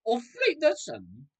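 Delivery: a hint of German accent, loud and high; bad German accent. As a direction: toss off "Auf Wiedersehen" in a mock German butler accent. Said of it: "I'm not good at a German accent".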